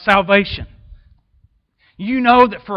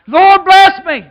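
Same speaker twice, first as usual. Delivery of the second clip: loud, soaring preacher's shout; full-force preaching; 10 semitones higher, 9 dB louder, much slower than usual. Acted. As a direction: preach it, lifting the crowd. Preaching run resumes.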